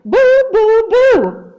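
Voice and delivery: loud, high ghostly boos; shouting. A narrator's reading. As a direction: shout each boo to scare the bat away. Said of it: "he shouted".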